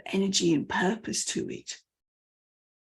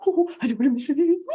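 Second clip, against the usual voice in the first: louder, higher, rushed burst; excited, fast; 6 semitones higher, 5 dB louder, much faster than usual. Shown as a demonstration. Listener afraid to miss anything, fast and excited. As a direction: rattle it out eagerly. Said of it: "You ramp up the excitement".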